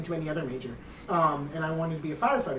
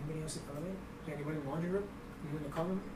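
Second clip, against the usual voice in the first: much softer, cautious half-whisper; hushed; 3 semitones lower, 16 dB quieter, much faster than usual. Retold or imitated. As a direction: creep around asking quietly. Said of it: "I'm like".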